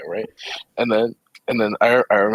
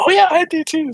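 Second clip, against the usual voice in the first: high, loud, eager agreement; mock over-eagerness; 5 dB louder than usual. Trying to bond with Alex over songs, mock eager. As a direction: play up desperate agreement, pitched way up.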